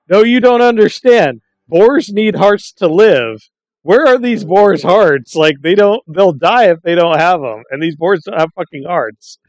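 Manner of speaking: high, slow, mock-insistent whine; exasperated player voice. Retelling sketch, player insisting.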